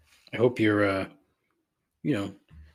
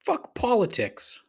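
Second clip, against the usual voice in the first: Fuck politics raised high and slow; a higher, slower retake of Fuck politics; 12 semitones higher, slower than usual. Shown as a demonstration. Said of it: "I can't even get it out of my fucking mouth".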